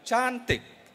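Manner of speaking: "cantik" stretched on a high tone; high emphatic tone. Shown as a demonstration. Lecturer demonstrates emphasis to the audience, "cantik" high and drawn out. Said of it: "dengan nada tinggi".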